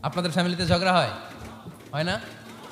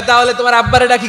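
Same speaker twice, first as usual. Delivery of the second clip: playful suggestion; 4 semitones higher, 6 dB louder than usual. Acted. Prophet's quote in story, first take.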